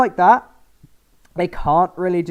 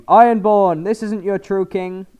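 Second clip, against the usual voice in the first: higher, louder proclaiming to a crowd; rallying call; 7 semitones higher, 9 dB louder, slower than usual. Retold or imitated. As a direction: call out to the Ironborn. Yara rallying Ironborn, proclaiming.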